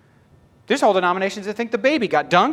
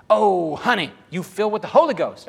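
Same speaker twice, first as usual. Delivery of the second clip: put-on higher, louder gushing old lady; crazy grandma; 4 semitones higher, 4 dB louder than usual. Crazy grandma phones Tim before his mission, gushing loudly. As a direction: exclaim it like an excited grandma on the phone. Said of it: "she calls me up".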